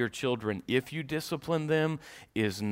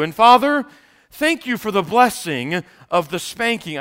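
loud, high pulpit preaching; rising loud sermon delivery; preaching at full force; 5 semitones higher, 9 dB louder than usual. Acted. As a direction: preach it out to the whole congregation.

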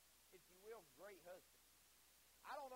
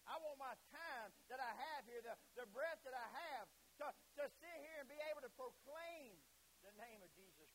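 raised, high preaching cry; impassioned resolve; 4 semitones higher, 6 dB louder than usual. Acted. Preacher voicing wish to proclaim Jesus, impassioned. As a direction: proclaim it with rising fervour.

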